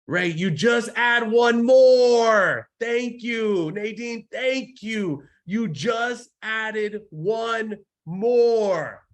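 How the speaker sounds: high, loud, stretched-out calls; drawn-out exultant shouting. Real, not acted. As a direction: stretch each key word into a long triumphant holler.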